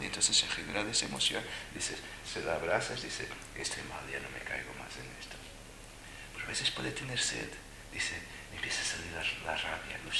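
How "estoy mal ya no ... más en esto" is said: susurrado, mucho más bajo; confiésalo en un susurro; susurro arrepentido. Retold or imitated. Voz interior del niño arrepentido. (susurrando como el niño que se arrepiente)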